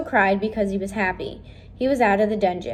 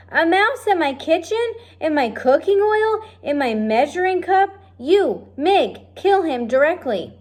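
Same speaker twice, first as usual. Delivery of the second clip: outraged cook; 8 semitones higher, 3 dB louder than usual. Acted.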